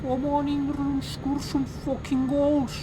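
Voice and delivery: high, slow, mock English football chant; mock English fan. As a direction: drawl it like a pub fan cheering England. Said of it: "mock English football fan".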